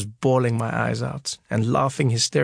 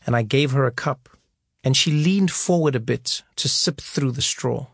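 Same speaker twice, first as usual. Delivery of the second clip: ordinary delivery, nothing marked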